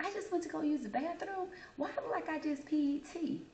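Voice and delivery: put-on high, puzzled kid voice; baffled, asking what happened. Confused kid telling friend.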